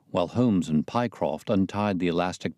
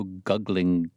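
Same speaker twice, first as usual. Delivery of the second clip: ordinary delivery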